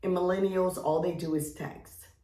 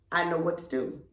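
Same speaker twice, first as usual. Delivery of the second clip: usual speaking voice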